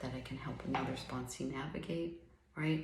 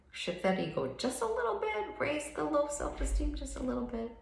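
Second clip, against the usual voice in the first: much higher, bright singsong coaxing; high mock chirp; 12 semitones higher, 4 dB louder than usual. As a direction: coax it along in a squeaky little voice. Mock encouragement.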